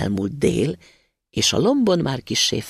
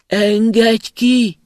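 slow, high, loud pleading cries from underground; a trapped spirit calling out to be freed, drawn out and loud; muffled trapped cry; 8 semitones higher, 7 dB louder, much slower than usual. A narrator's reading. Trapped spirit begging the passing student: slow, high, muffled cry. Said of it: "megint megszólalt a tompa hang".

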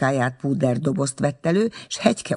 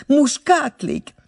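"muskátlik?" thrown up high and loud, slowly; delighted squeal of a question; excited cry; 14 semitones higher, 5 dB louder, slower than usual. A narrator's reading. The schoolgirl cries out in delight at the flowers. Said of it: "kiáltotta".

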